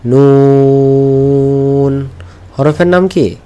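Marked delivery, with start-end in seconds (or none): 0.1-2.1 নুন with a very long, level oo; drawn-out letter name. Shown as a demonstration. Teacher introduces the next letter's name, drawn out. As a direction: hold the vowel of নুন on one note. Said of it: "এটা টেনে বলতে হবে".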